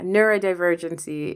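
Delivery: normal talking voice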